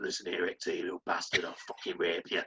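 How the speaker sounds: low, gravelly hard-man threat; Sean Dyche gruff impression. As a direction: growl it low like a tough football manager. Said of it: "who fucking talks like he eats gravel".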